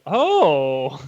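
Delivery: big swooping mock "oh"; acted realisation. Reader learns from the recap page, mock surprise.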